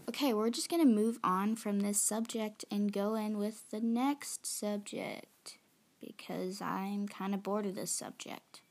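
ordinary talk, nothing marked

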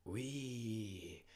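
soft, drawn-out ooh; startled awe. A narrator's reading. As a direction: breathe out a soft, amazed exclamation. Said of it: "sagten die Tiere und bekamen". (leises, gedehntes Staunen)

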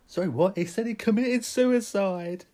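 high, loud, slow panic; mock-horrified shout; hammed-up alarmed cry; panicked outburst. Acted panicked exchange.

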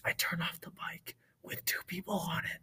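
hushed, urgent whisper; leaning in to whisper. Acted. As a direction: whisper so the enemies won't hear. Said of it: "We're sorry for whisper".

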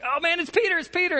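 high, thrilled squeal of a name; excited girl. Acted. Servant girl thrilled to find Peter knocking at the door, high and excited.

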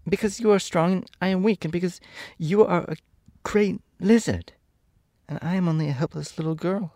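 put-on high-pitched small girl pleading; pleading little girl. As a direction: plead as a helpless child, pitched well up. Voices Dorothy pleading with Oz.

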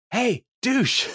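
high-pitched mock yell, no real volume; retold yell. Host retelling Leslie shouting in the parking garage, mock yell. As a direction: call it out high, like hailing someone. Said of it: "and yells out".

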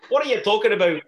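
loud, high Irish brogue. Shown as a demonstration. Performing on request, in a loud Irish brogue. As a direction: belt it out in an Irish accent. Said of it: "talk in your Irish brogue".

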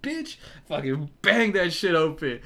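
retold exasperated outburst; frustrated curse. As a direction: swear at the jammed door. Forcing the stuck door.

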